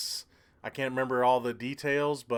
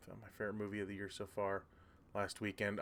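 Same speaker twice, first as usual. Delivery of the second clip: quick, much softer mumbled reread; rereading to oneself; 10 dB quieter than usual. Real, not acted. Streamer rereading a viewer's comment to himself, quiet and quick. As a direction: read it back quickly under your breath.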